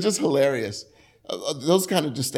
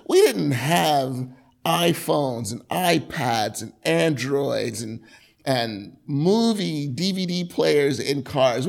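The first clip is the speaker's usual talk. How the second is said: normal talking voice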